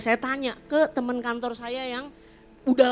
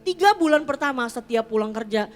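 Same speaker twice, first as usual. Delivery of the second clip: usual speaking voice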